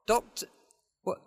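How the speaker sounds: ordinary delivery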